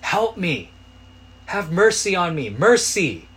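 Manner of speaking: high, loud cry for mercy; acted desperate shout. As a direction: shout it out over a crowd, begging. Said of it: "screaming at the top of his lungs".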